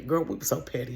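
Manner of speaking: usual speaking voice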